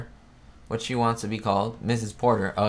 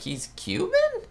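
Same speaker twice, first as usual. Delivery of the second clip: high, slow disbelieving he's Cuban; mock astonished rising question; surprised question; slower than usual. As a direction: gasp it out in shock. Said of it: "I was like".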